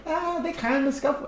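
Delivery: very high mock bystander voice; dismissive onlooker. Bystanders playing down the fight, dismissive. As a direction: imitate people shrugging it off, way up high. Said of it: "Because people might be like".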